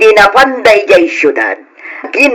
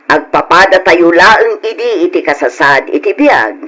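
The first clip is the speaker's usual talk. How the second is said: ordinary talk, nothing marked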